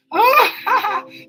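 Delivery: loud, high-pitched cackling laugh; teasing laugh. Real, not acted. Host teasing listeners about the tassel, laughing.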